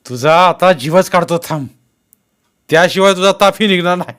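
loud, high menace; angry threat. A narrator's reading. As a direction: threaten the suspect with a beating. Policeman threatens to beat him.

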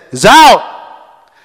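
loud umpire call, mock official; umpire's call. Umpire calling the runner out, loud mock call. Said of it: "the umpire said".

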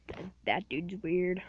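ordinary talk, nothing marked

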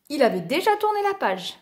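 ordinary delivery

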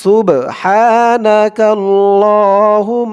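very slow, drawn-out chant; slow recitation. Shown as a demonstration. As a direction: intone each word slowly. Recites the text slowly.

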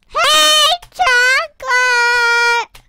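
happy shout to a friend; excited call. Real, not acted. Spotting her friend Chocolate.

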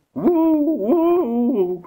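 long, high, loud howl; wolf howl. A narrator's reading. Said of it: "huilde hij".

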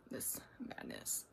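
whispered under the breath; whispering. Real, not acted. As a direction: whisper it, slowly. Muttering about messy desk.

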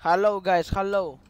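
loud hail to a group; calling out. Real, not acted. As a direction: call out strongly to get their attention. Calling to players who don't answer.

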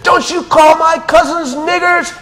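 very high, loud yell of defiance; angry shout. Retold or imitated. The boy yelling at the man who insulted his cousins, angry. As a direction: scream it at the man's face.

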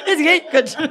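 laughing high and incredulous; high giggling disbelief. Real, not acted. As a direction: crack up as you say it. Laughing at dad's line.

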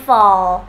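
"fall" isolated and drawn out on a dark L; final L. Shown as a demonstration. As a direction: model the ending "fall" by itself. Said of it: "Âm L cuối này".

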